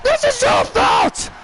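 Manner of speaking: high, slow, furious accusation; a wrestler furious at her teammate; angry blaming yell. Acted. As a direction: spit out the blame at her partner. Shotzi blaming Gigi.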